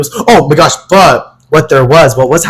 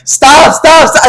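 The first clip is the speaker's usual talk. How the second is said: high-pitched mock panic; panicked plea; 10 semitones higher, slower than usual. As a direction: beg to be put down, voice pitched up. Reliving being spun around on stage.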